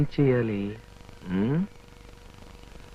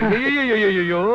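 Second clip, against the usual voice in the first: mock groan. Fake victim groans in car.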